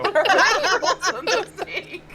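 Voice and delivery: echoing the line while cracking up; laughing. Real, not acted. Friend's line, then laughing echo.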